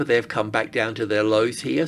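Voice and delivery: ordinary talk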